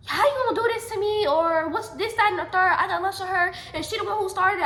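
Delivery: yelling back at the principal in disbelief; going off. Retold or imitated. Student protesting to the principal, loud and angry. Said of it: "I start going off on this man".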